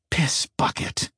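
soft unvoiced aside; whispered curse. A narrator's reading. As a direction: breathe the curse under your breath. Inner thought, whispered.

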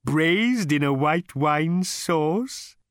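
high, slow, courteous cow; polite cow voice. A narrator's reading. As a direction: offer yourself like a cow waiter. The animal speaks.

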